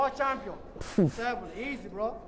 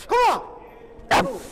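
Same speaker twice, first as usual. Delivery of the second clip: loud, high gym yell; shouting; 13 semitones higher, 7 dB louder, much faster than usual. Real, not acted. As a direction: scream it to push the last rep. Spotter yelling at the lifter.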